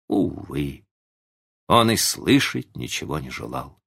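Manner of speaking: usual speaking voice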